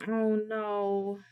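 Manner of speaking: ordinary talk, nothing marked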